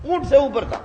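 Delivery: ordinary delivery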